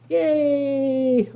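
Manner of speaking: long, high drawn-out yay; playful cheer. Real, not acted. Woman celebrating her dye job, playful cheer. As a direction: cheer it out in one stretched note.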